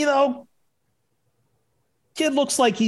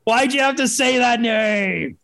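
mock outraged shout; 3 semitones higher, slower than usual. Friend yells about the dead grandmother's name, hammed-up outrage. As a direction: bellow it in fake indignation.

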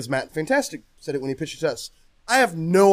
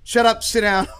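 higher, louder brush-off; imitated dismissive shushing; 6 semitones higher, 4 dB louder than usual. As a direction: wave them off, raised voice. Retold host shushing someone.

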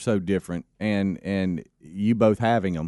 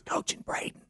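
whisper; 8 dB quieter than usual. Acted. Joking aside, whispered.

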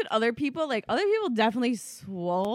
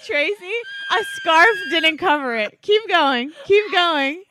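shouting at her friend to keep swiping; loud urging; 5 semitones higher, 5 dB louder than usual. Real, not acted. Urging her friend to swipe through the photos, loud. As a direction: call it out loud, half laughing.

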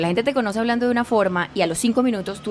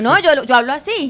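retold loud, higher protest; very loud talker; 5 semitones higher, 5 dB louder than usual. Venezuelan explaining herself to startled Bogotanos, loud. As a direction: push it out loud, defending your usual volume. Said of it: "Yo llegué hablando durísimo".

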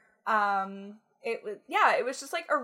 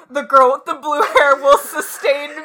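mock declaration; 5 semitones higher, 13 dB louder than usual. Hammed-up excited declaration.

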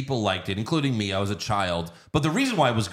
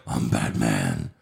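much lower, slow growled Batman line; Michael Keaton's Batman; slower than usual. Retold or imitated. Recalling Keaton's Batman to friends, deep slow growl. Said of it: "We went from Michael Keaton, like".

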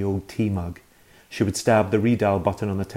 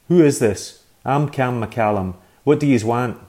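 louder, demanding who is this; gruff annoyed man; 4 semitones higher, 8 dB louder than usual. Acted. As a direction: snap back down the phone, louder. Man answers annoyed on the phone.

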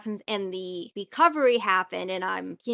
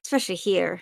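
ordinary delivery

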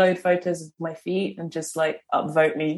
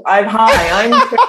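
laughing; 3 semitones higher, 6 dB louder, much slower than usual. Retold or imitated. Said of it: "I'm American".

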